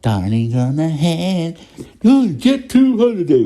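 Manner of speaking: high, slow, sing-song holiday-ad impression; mock Jet2 advert voice. Mocking the TikTok Jet2 holiday trend in a hammed-up advert voice. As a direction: ham up the cheesy holiday advert. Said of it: "This voice, like".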